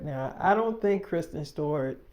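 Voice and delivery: normal talking voice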